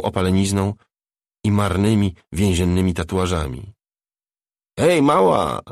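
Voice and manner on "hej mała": loud, high-pitched gleeful call; joyful shout. A narrator's reading. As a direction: shout it out as if delighted to spot her. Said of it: "krzyknęła gruba jakby z radością".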